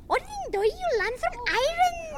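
high Irish lilt; Irish accent. Pretend. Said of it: "This is an Irish accent".

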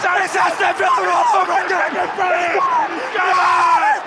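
high, loud delighted cry; ecstatic shouting. Real, not acted. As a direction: yell it out in joy. Celebrating the goal.

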